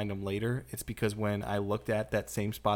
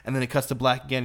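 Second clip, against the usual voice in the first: usual speaking voice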